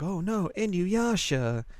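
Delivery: high, slow cartoon dismay. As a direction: wail it slowly and high. Group voiced in recap.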